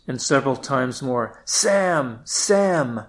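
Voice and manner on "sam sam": calling out. Acted. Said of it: "she shouts".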